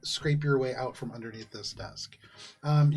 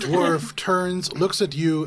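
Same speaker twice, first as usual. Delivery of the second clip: usual speaking voice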